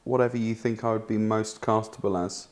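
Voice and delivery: ordinary talk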